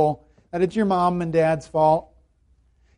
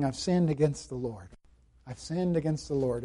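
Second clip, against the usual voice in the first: quiet, lower repeated admission; soft confession; 6 semitones lower, 11 dB quieter than usual. Acted. David admitting his sin before God, softly. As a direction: murmur it as a contrite confession.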